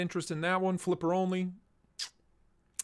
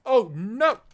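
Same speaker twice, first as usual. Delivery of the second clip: loud, higher cry of dismay; dismayed outburst; 5 semitones higher, 8 dB louder than usual. Real, not acted. Streamer spotting the stash light is off, dismayed. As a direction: exclaim as something suddenly fails.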